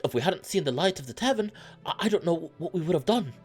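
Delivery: high, stammering character voice; rattled and high-pitched; shaken traveler. Acted. Same rescued traveler, stammering.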